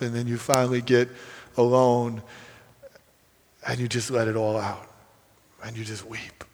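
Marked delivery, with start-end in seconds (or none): 3.7-6.4 fading to hushed. Retold or imitated. Describing finally breaking down alone.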